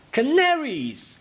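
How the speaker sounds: high, drawn-out exclamation; excited reveal. Acted. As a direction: announce it like a big reveal. Announcing answer to the question.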